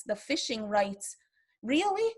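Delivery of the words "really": incredulous. Real, not acted. Incredulous at fishing rights.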